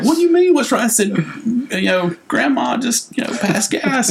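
very high, innocent protest; imitated bewildered kid. Voices his bewildered child self.

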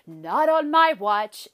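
loud, slow, steely resolve; a vow said out loud, slow and loud; firm declaration. Retold or imitated. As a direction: plant your feet and declare it. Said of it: "and I even said out loud".

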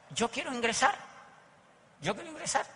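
a prospect telling the speaker he wants in, eagerly; eager recruit. Retold or imitated. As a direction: sound hooked but careful. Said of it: "Así, con esa actitud".

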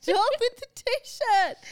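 slow, high, loud whiny plea. Real, not acted. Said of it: "teasing protest".

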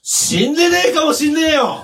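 high shouted contradiction; mock-angry denial. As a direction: shout him down in mock outrage. (ムキになって叫ぶ)

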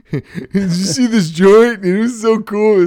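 high, put-on youngster voice; mocking young kid. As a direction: imitate an over-eager kid showing off. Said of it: "I love these young kids".

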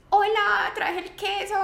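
high, breezy, laughing fake cheer; pretend-innocent hello with a laugh; mock cheerful greeting. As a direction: play it oblivious and bright, laughing through. Imagined awkward cheerful arrival.

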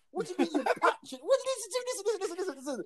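high, repeated "listen" in a put-on lad voice; mock street lad. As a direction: imitate a mate cutting you off. Mocking the boys' reaction.

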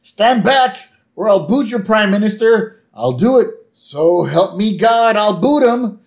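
high, loud hammed-up threat; mock hostage-taker yelling; playful imitation of a frantic Homer; Homer threatening. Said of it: "He's like".

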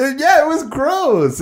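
high, slow, drawn-out disgust; playfully grossed out, slow and high. Real, not acted. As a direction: groan out how gross it was. Asked if the cucumber drink was good.

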